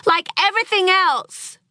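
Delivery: high, loud frustrated shout. Acted. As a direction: yell at the husband over the lost scoop. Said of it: "shouted Clementine".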